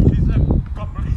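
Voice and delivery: laughing teasing. Real, not acted.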